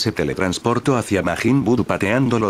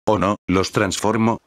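plain reading voice

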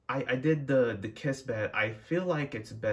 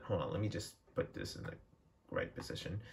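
whispered aside; 2 semitones lower, 9 dB quieter, faster than usual. Real, not acted. Adjusting something, muttered aside.

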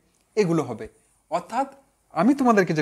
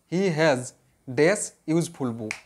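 slow dictation; 3 semitones lower, much slower than usual. Shown as a demonstration.

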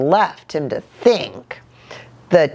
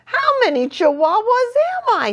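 very high kitten voice wondering; wondering aloud; faster than usual. A narrator's reading. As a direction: puzzle it out way up high. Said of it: "he wondered out loud".

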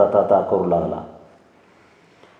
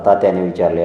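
ordinary delivery, nothing marked

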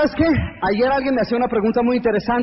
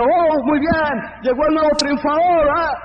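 hammed-up higher cheering; mock the cheerleaders who won't greet you, voiced higher; 6 semitones higher than usual. Speaker mocking cheerleaders welcoming the winner home, higher hammed-up cheer.

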